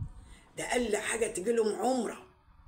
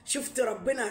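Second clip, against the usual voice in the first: ordinary delivery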